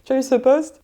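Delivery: high, stretched-out gushing congratulations; imitated excited friends. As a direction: play the thrilled friends, voice way up. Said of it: "ils se disaient".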